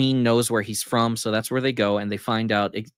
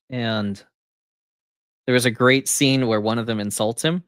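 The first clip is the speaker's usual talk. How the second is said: normal talking voice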